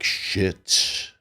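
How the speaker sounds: voiceless, breath-only mock dismay; dismayed reaction. Bettor hears the other man can do seventy, dismayed. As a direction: mutter it to yourself, voiceless. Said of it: "and I'm like".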